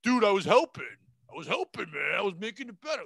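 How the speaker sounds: loud, defensive heckler shout; indignant heckler. Retold or imitated. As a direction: bark back like a drunk who thinks he helped. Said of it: "That guy after the show is gonna say".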